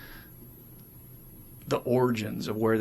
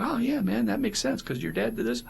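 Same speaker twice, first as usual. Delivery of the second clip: much higher friendly sponsor voice; sponsor voiced; 12 semitones higher than usual. Sponsor voiced high and easygoing answering a sponsee. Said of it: "they'll be like".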